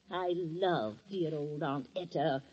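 slow, soft, sarcastic repeat; sneering mockery. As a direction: drawl it with scorn. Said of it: "sneering repeat of her sister's line".

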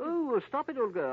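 high, loud, overcome with delight; swooning protest. Acted. Tweedles begs Jane to stop describing cereal, swooning. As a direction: beg her to stop, mock agony of craving.

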